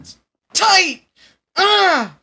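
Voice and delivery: very high, loud squeezed wail; high strained cry. Retold or imitated. Mocking the anime hero, a high loud strained cry. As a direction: cry out like it's crushing you. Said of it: "Never do that sound again".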